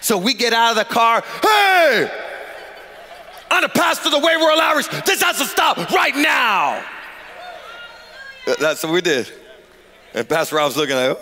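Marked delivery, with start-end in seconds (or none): 1.4-6.8 high, forceful shout across a crowd; acted commanding shout. Pastor breaks up a gang fight, commanding shout. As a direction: order everyone to stop at once.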